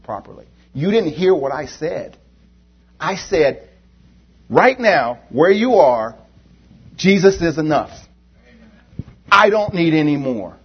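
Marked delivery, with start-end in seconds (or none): 4.5-10.6 louder, higher closing exhortation; rally the congregation to say no; preacher urging the church to renounce wants; preaching. Acted. Closing exhortation at full force.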